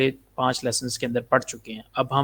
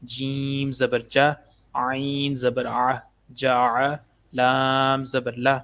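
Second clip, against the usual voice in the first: slow, drawn-out letter-by-letter drill; measured Qaida teacher's recitation; spelling drill recitation; much slower than usual. Shown as a demonstration. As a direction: intone each letter, then its syllable. Drills spelling out Quranic syllables.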